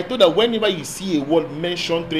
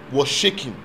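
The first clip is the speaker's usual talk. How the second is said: ordinary delivery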